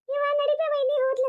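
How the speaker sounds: a light mimicking echo of her daughter's eager call; mocking the daughter. Mother scolding daughter, mimicking her eager hurry. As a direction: tease her words back at her, half-mocking. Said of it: "ಅಂತ ಹೇಳಿ".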